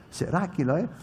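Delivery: usual speaking voice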